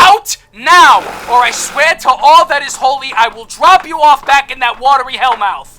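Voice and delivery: loud, high, enraged order to leave; furious shout. Acted. Sal ordering the interviewer out, furious shout. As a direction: roar him out the door.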